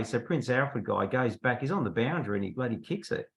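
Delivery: ordinary delivery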